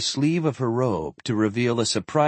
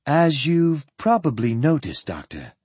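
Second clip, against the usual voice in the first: lightly voiced composed woman, a little higher; composed lady; 4 semitones higher than usual. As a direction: explain with poise to a nervous doctor.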